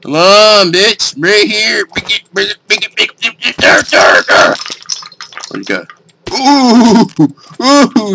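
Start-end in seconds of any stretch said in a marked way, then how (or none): none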